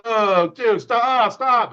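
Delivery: high, loud panicked teammates yelling; imitated alarmed yell. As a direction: cry out in mock alarm, stretching the words. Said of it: "you hear everybody practice go".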